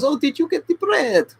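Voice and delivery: a high put-on Russian wife asking her husband. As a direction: squeak it out as the wife. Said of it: "and she goes".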